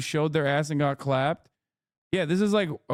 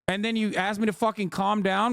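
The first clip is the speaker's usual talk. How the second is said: normal talking voice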